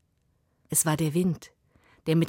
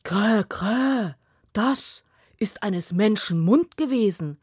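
louder raven croak; third raven calling; 3 semitones higher, 4 dB louder, slower than usual. Acted. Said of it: "rief der dritte".

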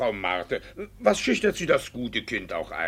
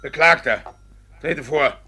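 loud summons; 3 semitones higher, 8 dB louder than usual. Acted. Judge summons defendant.

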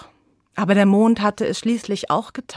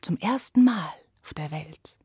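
softer hushed whisper; 3 semitones lower, 8 dB quieter than usual. A narrator's reading. Narrator whispering as night falls, hushed. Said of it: "whispered narration night falls".

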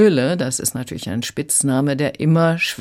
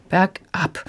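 ordinary talk, nothing marked

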